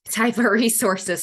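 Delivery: strained voice after choking; choking a little. Real, not acted. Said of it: "Sorry, I was choking a little bit".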